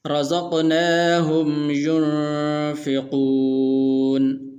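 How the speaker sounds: very slow melodic chant; Quran recitation. Shown as a demonstration. Teacher reciting the verse to students in slow melodic tajweed. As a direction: sing out the verse slowly, stretching the vowels.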